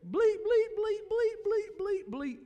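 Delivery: high squeaky bleep noises for cussing; comic high bleeping for a cussing refusal; censor bleeps. Retold or imitated. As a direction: squeak out censor beeps in place of swear words. Friend's cussing voiced as censor bleeps.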